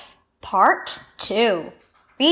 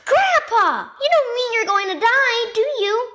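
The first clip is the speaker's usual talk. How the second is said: put-on much higher, frightened boy's question; alarmed plea; 11 semitones higher than usual. A narrator's reading. Boy in the hospital room fears his grandfather will die, much higher and alarmed. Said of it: "Asher gasped".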